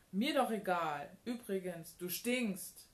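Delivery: loud, dismissive retort; snotty parting shot. A narrator's reading. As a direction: throw the insult back loudly. Ida's parting insult in quarrel. (laut und patzig)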